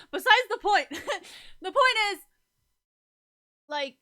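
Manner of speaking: loud, high laughing through the words. Real, not acted. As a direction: burst out laughing while getting back on track. Cracking up at own tangent.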